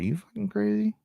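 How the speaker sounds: high, sharp disbelief; incredulous. Real, not acted. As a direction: voice jumps up in disbelief. Incredulous at walking in cold.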